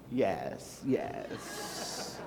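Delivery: breathy mystic whisper. Acted. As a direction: hush it like a secret omen. Seer murmurs while host turned.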